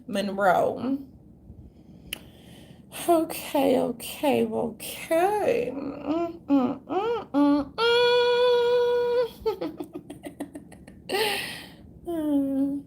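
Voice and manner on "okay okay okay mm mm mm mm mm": high, whimpering fake sob on okay; hammed-up weepy moaning, higher; a put-on breaking cry, high and strained; mock crying.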